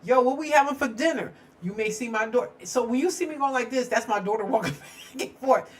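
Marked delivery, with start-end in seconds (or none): 0.0-1.3 mock husband yelling from another room, louder and higher. Husband shouting across the house about dinner, mock yell. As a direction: call out like a hungry husband. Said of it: "my husband yell".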